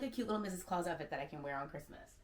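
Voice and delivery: ordinary talk, nothing marked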